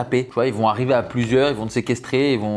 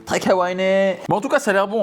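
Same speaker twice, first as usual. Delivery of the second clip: cutesy high voice; 8 semitones higher than usual. Pretend. Cutesy remark on biscuits.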